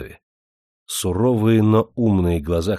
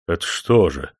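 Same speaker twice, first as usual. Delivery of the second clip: put-on agitated old man; 4 semitones lower than usual. A narrator's reading. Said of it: "взволновался старик".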